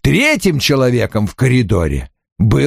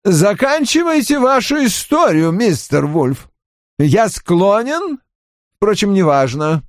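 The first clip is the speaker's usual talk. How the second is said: Hewitt voiced higher, firm and steady; a firm, unbending demand, pitched up; 9 semitones higher than usual. A narrator's reading. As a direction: Hewitt coolly tells Wolfe to finish, firm and high. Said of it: "твёрдый голос".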